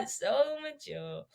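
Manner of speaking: slow, soft, teasing awe; drawn-out fake admiration; mock impressed. Repeats slower, softer.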